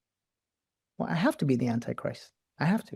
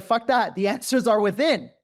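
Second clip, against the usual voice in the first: loud mock defiance; 8 semitones higher, 7 dB louder than usual. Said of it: "It's like".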